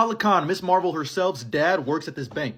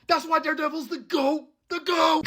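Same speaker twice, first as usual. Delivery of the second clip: hyped shout; 11 semitones higher than usual. Real, not acted. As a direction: yell like your favorite hero just won. Hyped recap opener.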